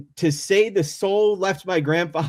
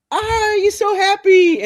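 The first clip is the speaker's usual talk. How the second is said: high, slow, excited buddy voice, louder; 6 dB louder, slower than usual. Retold or imitated. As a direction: stretch it out like a thrilled friend in the street.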